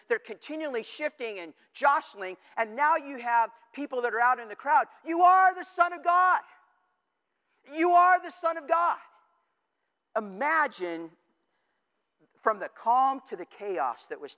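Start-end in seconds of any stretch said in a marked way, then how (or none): 5.1-9.0 demons shouting. Retold or imitated. People out in the crowd shouting at Jesus, loud high shout.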